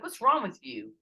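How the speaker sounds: acted-out accusing question; retold challenge. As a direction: imitate friends asking in loud bafflement. Team's question in retold quarrel.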